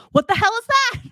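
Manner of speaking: high, loud mock yell of shock; startled husband shout. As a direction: blurt it out like a husband who just spotted a strange cat. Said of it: "He goes".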